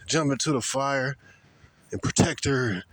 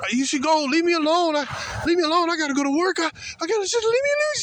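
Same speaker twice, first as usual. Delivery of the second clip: mock squealing woman; 13 semitones higher, faster than usual. Mocking the woman screaming at police, high squeal. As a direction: squeal like she's screaming at cops. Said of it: "starts screaming and squealing".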